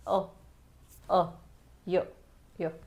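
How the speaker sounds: short isolated अ and य sounds, each said on its own; sound demo. Shown as a demonstration. Teacher sounds out the missing य sound for students, clipped and separate. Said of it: "य की sound तो आ ही नहीं रही ना".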